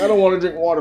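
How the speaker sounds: high put-on sulky voice; teasing imitation of his wife refusing; whiny refusal. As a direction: mimic her stubborn pout. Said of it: "She's like".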